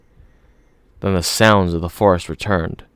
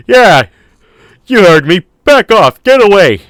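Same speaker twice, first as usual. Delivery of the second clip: high, loud defiant yells; shouting; 11 semitones higher, 9 dB louder than usual. A narrator's reading. Man on his feet yelling defiance at the dinosaur, loud shout. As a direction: roar it out like you mean it. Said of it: "Regis was shouting".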